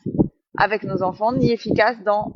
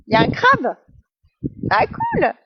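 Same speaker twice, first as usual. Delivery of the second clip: surprised; 14 semitones higher, 4 dB louder than usual. Real, not acted. Spots a crab mid-sentence.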